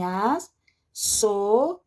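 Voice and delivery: ASSOBIAR very slowly as as, so, bi, ar; syllable by syllable. Shown as a demonstration. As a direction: break "assobiar" into syllables with long pauses. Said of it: "vai ficar separado assim".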